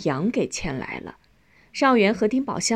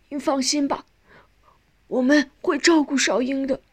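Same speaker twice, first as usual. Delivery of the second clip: higher, heartfelt promise; emotional reassurance; 6 semitones higher than usual. A narrator's reading. Son promising to care for his sister, emotional. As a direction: reassure a dying parent, voice lifted.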